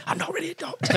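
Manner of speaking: high-pitched, hushed retold admission; mock confession. Comic owning up to a radio guest in the break, high confession. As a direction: sheepishly own up in a high voice.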